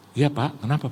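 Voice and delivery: normal talking voice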